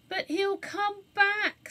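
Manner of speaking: slow, high, mournful worry. Acted. Anthea fretting to the others, mournful. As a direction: lament that he will return. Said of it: "said Anthea mournfully".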